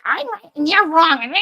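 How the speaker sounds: high, bratty mock-child voice; childlike whining. Mocking people stuck at child stage, childlike whine. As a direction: whine it like a stubborn little kid. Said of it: "go with that childlike".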